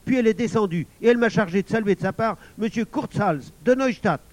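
put-on loud, high, eager boy; high, urgent, insistent testimony; excited outcry. A narrator's reading. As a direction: blurt out proof of a witness. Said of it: "s'écria Émile".

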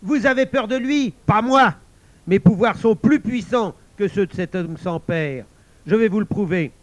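high, loud, defiant outburst; furious villain shouting his challenge; Ikotomé shouting. Acted. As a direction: bellow a jealous boast at the elders. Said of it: "Ikotomé cria".

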